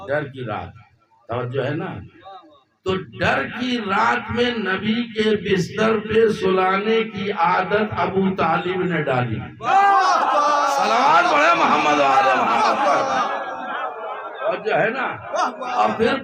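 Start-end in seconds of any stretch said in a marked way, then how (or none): 2.8-9.4 high, loud, sung-out sermon building in fervour; fervent majlis recitation, half chanting; preaching at full force. Acted. Zakir's majlis rising to full force. 10.8-13.2 high, loud call to the gathering; ringing call to recite salawat. Acted. Calling the crowd to salawat.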